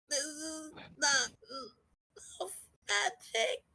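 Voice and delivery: words squeezed through clenched teeth; slow, strained, teeth-clenched whisper; through gritted teeth. Real, not acted. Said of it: "talking through my teeth".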